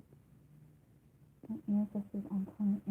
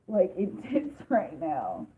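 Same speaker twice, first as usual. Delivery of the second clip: laughing; 2 semitones lower, 5 dB louder, much slower than usual. Real, not acted. Laughing at the intense energy.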